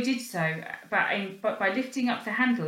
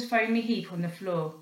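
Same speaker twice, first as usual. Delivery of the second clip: usual speaking voice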